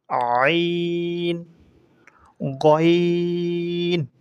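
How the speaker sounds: আইন and গইন each drawn out very long from the throat; ain and ghain. Shown as a demonstration. Teacher leads pupils to say আইন, গইন together, slow. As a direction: stretch আইন, গইন slowly. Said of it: "একসাথে বলি".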